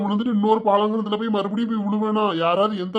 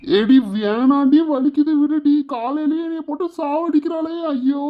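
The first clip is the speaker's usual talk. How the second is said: pained pleading; 6 semitones higher than usual. Acted. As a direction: cry out and beg under blows. Husband wails while wife beats him. (வலியில் கெஞ்சி அலறல்)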